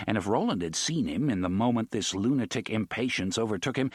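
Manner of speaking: ordinary delivery, nothing marked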